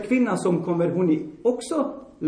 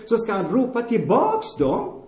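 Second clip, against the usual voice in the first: usual speaking voice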